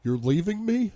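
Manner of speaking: alarmed question. A narrator's reading. Husband asks wife on phone, alarmed. Blurts out fear of being left.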